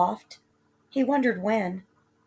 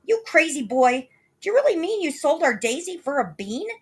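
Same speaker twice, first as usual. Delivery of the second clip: higher, louder shocked outburst; outraged cry; 8 semitones higher, 5 dB louder than usual. Acted. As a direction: cry out in disbelief. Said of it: "leaped high up in the air and cried".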